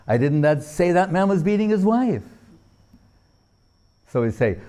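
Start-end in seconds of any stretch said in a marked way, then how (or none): 0.1-2.2 higher, "wife" with a strong fall; stress on WIFE. Shown as a demonstration. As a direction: end hard on "wife". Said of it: "According to where the emphasis is placed".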